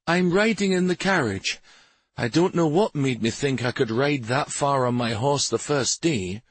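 high character voice, complaining of soreness; sore complaining rider. A narrator's reading. Limping rider choosing the carriage, complaining.

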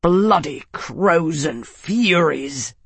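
slow, tight-throated snarl. A narrator's reading. Arnas startled by Lady Aquitaine, slow tight snarl. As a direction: curse it through a tight throat. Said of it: "snarled Arnus, his throat tight".

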